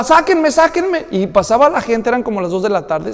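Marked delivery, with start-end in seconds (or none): 0.0-1.1 high, slow pleading cries; trapped boy in the drain calling for help, high and slow; desperate cry. Retold or imitated. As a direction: beg to be pulled out, high-pitched. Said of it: "Y gritaba".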